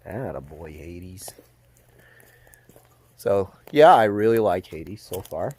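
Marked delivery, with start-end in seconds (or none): 0.1-1.3 whispered horse's name. Real, not acted. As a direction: breathe his name to calm him. Praising colt softly.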